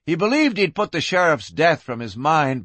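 plain reading voice

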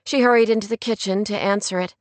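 usual speaking voice

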